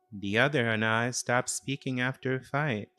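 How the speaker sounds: ordinary delivery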